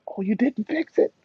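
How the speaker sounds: high little put-on voice; imitated surprised onlooker. Imitates people seeing his smile.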